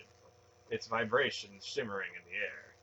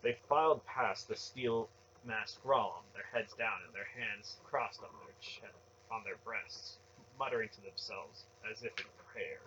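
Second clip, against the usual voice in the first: plain reading voice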